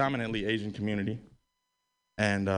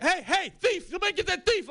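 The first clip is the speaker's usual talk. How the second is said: mock calling out. Calling out at a thief.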